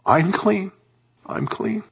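high, wondering realization, softening on the repeat; joyful change. Retold or imitated. Preacher voices the cleansed leper, high-pitched wonder. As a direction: lift the pitch right up, as if just healed. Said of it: "And then there's a change".

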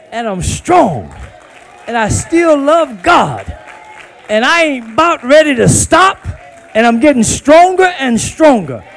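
preacher shouting out his strength; loud defiant proclamation; rising shout. Real, not acted. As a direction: declare it loud, building.

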